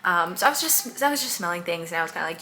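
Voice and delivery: usual speaking voice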